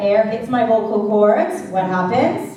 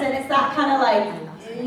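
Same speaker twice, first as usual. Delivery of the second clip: ordinary talk, nothing marked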